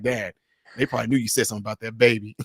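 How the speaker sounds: lightly voiced son. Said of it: "my son was like".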